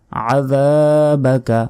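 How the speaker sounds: slow chanted word with a long held vowel; তিলাওয়াত. Shown as a demonstration. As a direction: recite it drawn out, falling at the end. Recites the corrected word. (সুর করে টেনে তিলাওয়াত)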